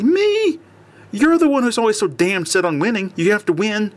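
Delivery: high, indignant disbelief at her husband; a wife firing back, outraged; heated quarrel. Acted. Wife scolding her husband, indignant and high.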